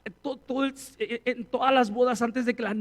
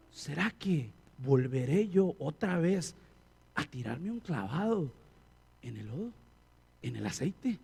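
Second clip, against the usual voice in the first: tempted inner voice; 9 semitones lower, 6 dB quieter than usual. Retold or imitated. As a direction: muse to yourself, half tempted. Tempted believer musing inwardly.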